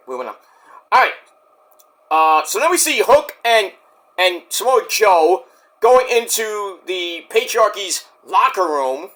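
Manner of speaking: ordinary talk, nothing marked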